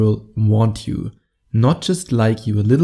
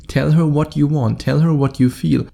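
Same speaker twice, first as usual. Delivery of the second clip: usual speaking voice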